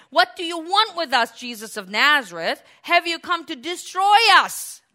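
loud, high confrontational cry. Acted. Possessed man questioning Jesus of Nazareth, crying out. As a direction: cry out in challenge. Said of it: "starts to cry out".

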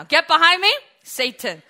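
loud rebuke falling on Satan; stern rebuke. Acted. Jesus rebuking Peter, loud and stern. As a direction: hit it hard, then drop. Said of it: "Say it with me".

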